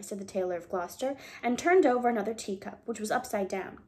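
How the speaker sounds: plain reading voice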